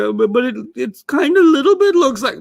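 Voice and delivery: high, pleading excuse voice; a hedging student, voice pushed way up; whiny student excuse. Retold or imitated. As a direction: squeak out a weak excuse like a kid. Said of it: "You could say".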